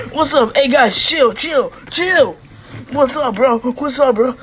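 high, loud, heated bursts; brawl shouting. Acted. Wrestler confronting rival's crew over his brother, angry shouting. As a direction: yell challenges into a play fight.

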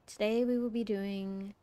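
soft, slow, calming teacher voice; mellow. Retold or imitated. Biology teacher welcoming the class, mellow. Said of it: "She had, like, a very mellow voice".

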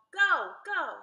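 loud, urgent hurry-up; loud urging. Acted. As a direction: push him along, loud and quick. Annie urging Jack down loudly.

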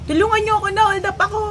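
high, loud pleading shout; retold cry for help. To anyone nearby after the shooting, loud plea. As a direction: call out that you've been robbed. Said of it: "Nagsisigaw ako".

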